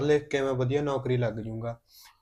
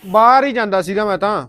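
loud, higher-pitched defensive answer; defensive retort; 6 semitones higher, 10 dB louder than usual. Acted. Brother stopped on his way out, answering back defensively. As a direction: answer back loudly, voice raised. Acted confrontation over going out.

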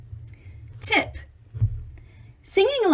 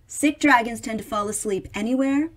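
ordinary delivery

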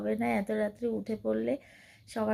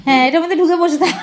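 laughing tease; 7 semitones higher, 13 dB louder, faster than usual. Real, not acted. Teasing her playing child, laughing.

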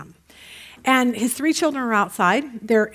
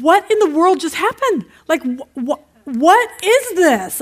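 loud, high, stammering disbelief; he can't believe what he sees; husband's high, loud outburst, slowing at the end; shocked husband; 6 semitones higher, 6 dB louder, slower than usual. Retold or imitated. Husband shocked at messy house.

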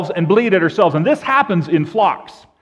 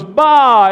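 answering "baa", higher and louder; sheep bleat; 6 semitones higher, 6 dB louder than usual. Acted. Second sheep answering the first, bleating. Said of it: "the second one goes".

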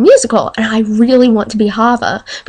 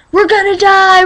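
mock panic; 10 semitones higher than usual.

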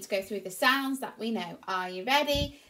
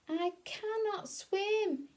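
higher, slow, soft sad duckling; sad little cheep; 10 semitones higher, 3 dB quieter, much slower than usual. Acted. Duckling three stuck on the bank, soft sad cheep. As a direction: say it small and sad. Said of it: "he cheeped".

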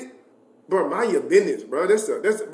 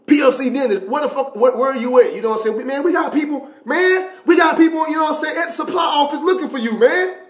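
sergeant yelling; 8 semitones higher, faster than usual. Retold or imitated. First Sergeant Kane calling the private, yelling. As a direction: bark like a furious sergeant on the phone. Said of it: "First Sergeant Kane called me".

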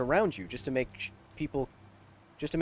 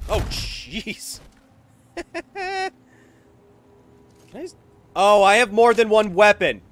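loud, higher delighted outburst; excited; 7 semitones higher, 9 dB louder than usual. Real, not acted. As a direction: blurt it out thrilled. Surprised discovery mid-game.

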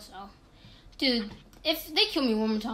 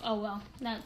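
ordinary talk, nothing marked

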